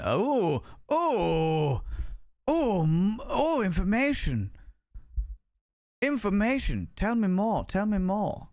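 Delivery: high, drawn out "oh, information"; mock thrill. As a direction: gush over the info like a treat.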